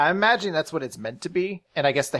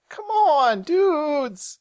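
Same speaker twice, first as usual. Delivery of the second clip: high, drawn-out whine; hammed-up high-pitched protest; pleading; 12 semitones higher, much slower than usual. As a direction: beg your friends in a high voice. Acting Finral pleading with friends.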